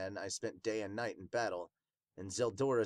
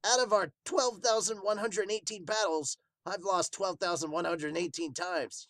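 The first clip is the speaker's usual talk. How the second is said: raised, earnest character voice; Wild's voice; 6 semitones higher, 4 dB louder than usual. Acted. Wild tells the group about his lost battles, earnestly. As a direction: recount the defeats with gusto.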